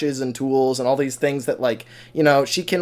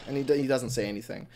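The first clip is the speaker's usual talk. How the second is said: ordinary talk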